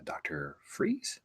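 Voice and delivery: "Doctor Freeze" said in a soft, slow, unvoiced whisper; slow whispered "Doctor Freeze", half to himself; wrong name. Real, not acted. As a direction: muse "Doctor Freeze" under the breath, unsure of the name. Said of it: "Mister Freeze".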